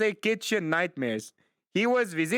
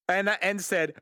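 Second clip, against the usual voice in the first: usual speaking voice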